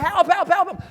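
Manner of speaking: high, loud acted cries for help; screaming. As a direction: scream in mock terror, rapid repeats. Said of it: "he's screaming".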